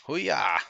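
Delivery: sharp high battle cry; karate yell. Real, not acted. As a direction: yell it like a kick. Attack yell in game.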